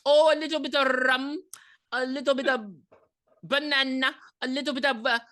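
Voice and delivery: high, sing-song Jamaican guy voice; Jamaican accent. Retold or imitated. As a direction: play the Jamaican guy listing ingredients in a lilting accent. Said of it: "and he's like".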